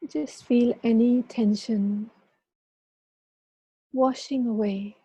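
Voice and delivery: calm guiding voice with long pauses; guided meditation. Acted. Leads a meditation.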